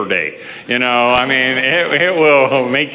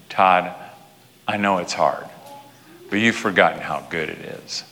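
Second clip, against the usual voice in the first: gentle inner voice; 7 semitones lower, 6 dB quieter than usual. Retold or imitated. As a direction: reassure him quietly. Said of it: "I could almost hear his voice say".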